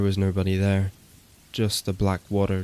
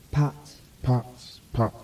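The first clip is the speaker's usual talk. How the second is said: higher, eerie overlapping calls; like voices bouncing from everywhere; screamed echoes; 4 semitones higher than usual. Acted. The woods screaming the name back, eerie higher calls. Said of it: "the woods screamed back at us".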